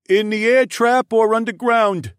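very high, loud creature voice; Tunglian creature. Acted. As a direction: pipe up loudly in a high-pitched voice. Tunglian creature asks in dialogue.

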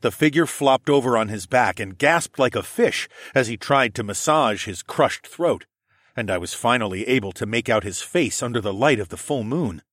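ordinary delivery, nothing marked

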